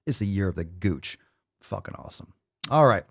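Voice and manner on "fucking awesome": breathy aside, no voice; whispered aside after the plug. Real, not acted.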